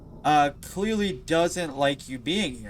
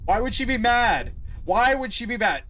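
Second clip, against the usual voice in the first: higher, louder fake innocence; sing-song mock question; mock innocent question; 8 semitones higher, 4 dB louder than usual. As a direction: play dumb, raised voice. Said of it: "She's furious".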